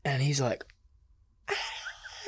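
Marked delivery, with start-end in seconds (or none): 1.5-2.3 high, soft strangled cry; scream of pain. Acted. Wolf biting the spiny porcupine, pained scream. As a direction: start a thin, high scream. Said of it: "just screams out".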